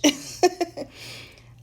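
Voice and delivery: high loud burst of laughter; laughing out loud. Real, not acted. Laughing at her own story, loud laughter. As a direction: crack up at your own punchline.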